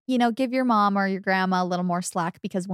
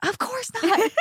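very high squawk of mock outrage; exaggerated high-pitched indignant mom; mock freak-out; 14 semitones higher than usual. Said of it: "she's gonna freak out".

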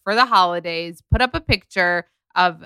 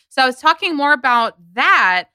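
ordinary talk, nothing marked

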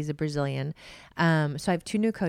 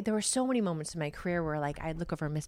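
ordinary delivery

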